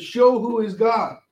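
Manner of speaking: high, slow imitated call to heaven; retold calling out. Prophet calls on God to show himself, calling out. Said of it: "And then he calls out".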